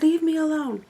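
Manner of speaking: high, irritated leave me alone; retold snap. Snapping at the friend interrupting her book, high and annoyed. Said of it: "and I snapped".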